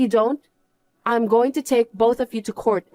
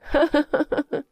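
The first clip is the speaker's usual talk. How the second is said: mocking laugh; 6 semitones higher than usual. Wife laughs at his downfall.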